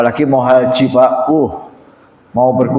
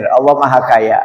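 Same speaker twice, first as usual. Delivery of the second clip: ordinary talk, nothing marked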